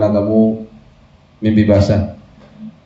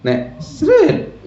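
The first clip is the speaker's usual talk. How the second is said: sharp high squirt noise; a quick imitated spurt; squirt sound. Shown as a demonstration. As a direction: sret said as a sudden high squirt. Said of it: "ngono keluar".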